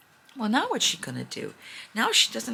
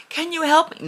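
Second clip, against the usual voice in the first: higher, louder plea for help; pleading little girl; 7 semitones higher, 5 dB louder than usual. Retold or imitated. Girl begging passersby at the door, higher and pleading. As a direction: reach up in a small begging voice.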